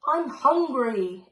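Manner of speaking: loud, slow hungry dragon roar. A narrator's reading. Dragon on the steeple to the town, roar. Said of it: "it roared".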